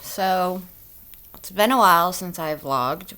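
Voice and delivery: normal talking voice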